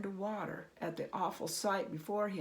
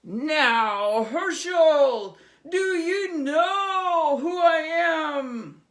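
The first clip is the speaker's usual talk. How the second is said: much higher, very loud, slow; triumphant goblin king; 8 semitones higher, 16 dB louder, much slower than usual. Acted. Goblin king gloating at Herschel, roaring. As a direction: gloat over him.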